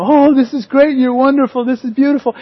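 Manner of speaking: a hammed-up imitation of delight; gushing homeowner. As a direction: overdo the thrilled praise. Homeowners gushing at their makeover.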